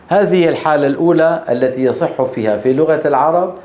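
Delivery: ordinary talk, nothing marked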